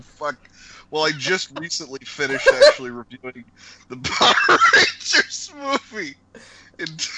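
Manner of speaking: giggling through the words; can't stop laughing while talking. Real, not acted. Cracking up mid-plug.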